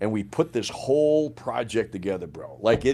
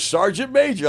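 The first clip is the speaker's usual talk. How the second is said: high-pitched imitation of an officer calling; 9 semitones higher, 4 dB louder than usual. Colonel summoning the sergeant major, calling out. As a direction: call the rank out, imitating the colonel. Colonel calls him out, retold.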